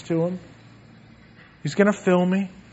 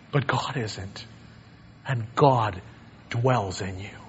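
sudden hush; 9 semitones lower, 9 dB quieter than usual. Acted. Preacher to congregation, God dwells in you, sudden soft hush. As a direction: drop right down, much lower and softer.

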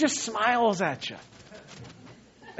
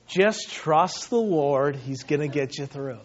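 Pastor Chuck, reassuring; 6 semitones lower, 4 dB quieter than usual. Retold or imitated. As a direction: settle them with unhurried assurance. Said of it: "got that gleam in his eye".

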